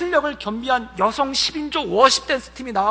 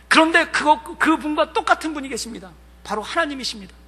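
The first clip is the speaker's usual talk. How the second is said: ordinary talk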